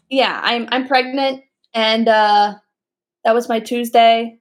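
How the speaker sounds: ordinary delivery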